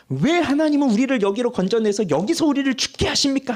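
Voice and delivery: frustrated outcry. Retold or imitated. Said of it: "하는 그 답답함이".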